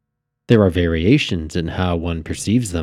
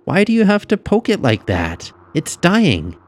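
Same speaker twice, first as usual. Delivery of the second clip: put-on high-pitched upset girl; frowning girl's reproach; 11 semitones higher, 4 dB louder than usual. A narrator's reading. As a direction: scold your sister in a high child's voice. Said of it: "Lisa said with a frown".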